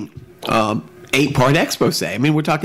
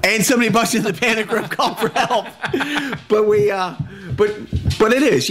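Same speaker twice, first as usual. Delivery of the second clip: higher-pitched, laughing delivery; 6 semitones higher than usual. Real, not acted. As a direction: talk through leftover laughter. Joking on while laughing.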